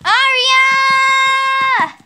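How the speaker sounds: loud, high call of Aria. Pretend. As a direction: shout her name from the next room. Calling out to Aria.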